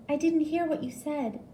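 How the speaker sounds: high nurse voice; lightly voiced nurse. A narrator's reading. Nurse telling patient she didn't hear, high.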